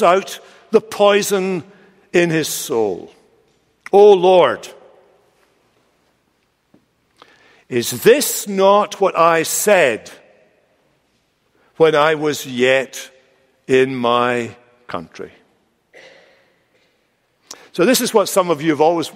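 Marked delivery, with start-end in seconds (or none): 3.9-15.3 slow, aggrieved prayer in a huff; angry, moany prayer. Acted. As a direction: moan it out slowly to God, pausing between phrases. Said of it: "it's a very angry, moany kind of prayer".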